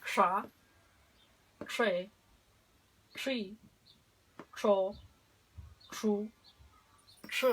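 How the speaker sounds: "sha, she, shi, cho, shu, shi" separadas y claras; sílabas con sh. Shown as a demonstration. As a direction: marca cada sílaba con sh. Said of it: "Vamos a practicar". (práctica de sílabas con sh)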